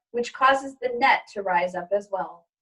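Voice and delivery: ordinary delivery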